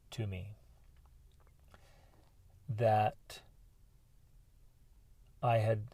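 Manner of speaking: low, slow, halting confession; heavy remorse. Retold or imitated. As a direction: let the regret weigh down each phrase with long pauses. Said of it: "just deep, deep, deep remorse".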